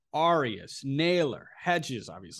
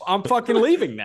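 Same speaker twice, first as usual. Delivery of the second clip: laughing shout; 9 semitones higher, 7 dB louder than usual. Pretend. Laughing mock exit.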